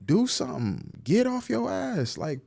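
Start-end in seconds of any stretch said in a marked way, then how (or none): 1.0-2.2 high scolding. Real, not acted. Scolding complainers.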